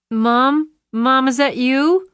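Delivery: put-on high, plaintive child's call, slowed; a scared daughter reaching for her mom; frightened girl. A narrator's reading. Lisa calls out to her mother on the phone, high and plaintive.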